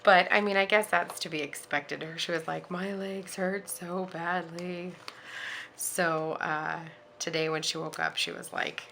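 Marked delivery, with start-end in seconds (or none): 2.7-4.9 slow, mildly pained imitation of a child; imitated tearful complaint. Mother retelling her sore daughter's complaint, mildly tearful imitation. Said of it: "She started crying".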